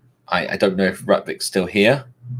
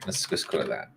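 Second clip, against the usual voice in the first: whispered aside; 9 semitones lower, 9 dB quieter than usual. Real, not acted. Aside after gesturing.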